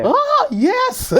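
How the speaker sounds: high, loud, gushing yes; delighted confirmation. Retold or imitated. Radio host recognised while ordering, delighted confirmation. Retells answering fans who recognise her.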